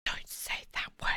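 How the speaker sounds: hushed mock scolding whisper; playful whispered telling-off. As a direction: whisper it like a secret warning.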